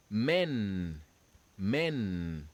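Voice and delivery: "men" said like English "men"; French "men". Shown as a demonstration. Teacher models the French word for students. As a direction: model "men" clearly for the class. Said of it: "This is how this word is pronounced".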